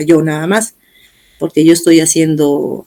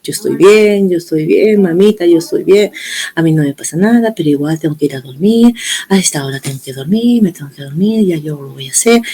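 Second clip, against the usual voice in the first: repetitive singsong, as if in a trance; trance-like repetition. Retold or imitated. Mother re-enacting her son's trance in the car, trance-like. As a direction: imitate someone looping anxiously through the same phrases. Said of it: "a tenerse un trance así".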